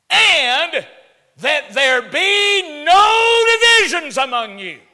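high, loud, drawn-out preacher shout; thundering scripture reading. Acted. Preacher reading Paul's plea against divisions, shouted high and slow. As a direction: belt out the verse, stretching the words.

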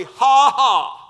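loud mocking ha ha, God scorning the heathen; derisive laugh. God laughing at the rulers in loud derision. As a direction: laugh in scorn, not in fun. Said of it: "it's derision".

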